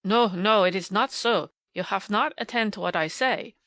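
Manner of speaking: loud, emphatic German-tinged correction. A narrator's reading. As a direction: insist firmly, teacher losing patience. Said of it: "heard him say emphatically".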